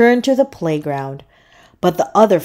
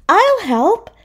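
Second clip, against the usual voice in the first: high-pitched seahorse declaration; declaring; 13 semitones higher, 3 dB louder than usual. A narrator's reading. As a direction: offer help brightly in a high little voice. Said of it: "Cece declared".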